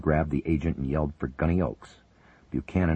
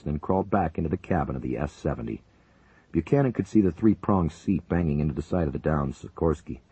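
ordinary delivery

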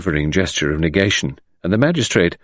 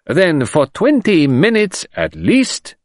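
slow, drawn-out reckoning; slow, high, deliberate; said slowly; 11 semitones higher, 3 dB louder, slower than usual. Acted. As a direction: think it through aloud, slowly. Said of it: "said the commissary slowly".

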